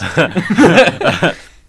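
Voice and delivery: high loud burst of laughter. Real, not acted. Host cracking up at his own recap, loud laugh. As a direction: crack up hard. Laughing at Jesus eye contact.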